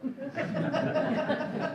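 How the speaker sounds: high, loud burst of laughter; sudden amused laugh. Real, not acted. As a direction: break into a real laugh. Laughter at counting siblings.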